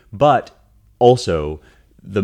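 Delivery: ordinary talk, nothing marked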